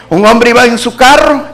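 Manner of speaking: usual speaking voice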